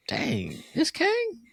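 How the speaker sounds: high squeaky mock surprise; mock disbelief. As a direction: act baffled and let down. Mock reaction to Ant-Man Kang.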